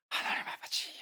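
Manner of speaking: imitated anguished wail, kept quiet; retold tearful cry. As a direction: cry out to God in a thin, stifled wail. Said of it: "그래서 막 그렇게 울더래요". (울부짖음을 작게 흉내 내듯)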